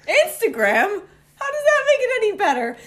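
high, loud, fast and laughing; laughing outrage at "Instagram"; incredulous. Retold or imitated. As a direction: throw his excuse back at him in disbelief. Outraged at cheater's excuse.

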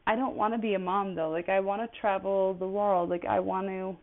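ordinary talk

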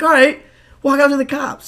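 rally the troops; rallying call. Retold or imitated.

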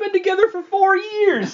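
high incredulous outburst; outraged protest. Acted. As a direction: protest in exasperated disbelief. Girlfriend protests his hesitation.

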